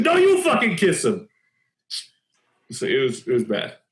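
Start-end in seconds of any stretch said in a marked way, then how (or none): 0.0-1.3 mild outburst. Retold or imitated. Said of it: "I just erupted".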